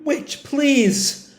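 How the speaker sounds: high, acted weeping plea; lightly acted tearful begging. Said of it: "openly weeping".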